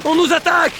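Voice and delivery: alarm shout. Acted. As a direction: sound the alarm loudly. Alarm call in battle game.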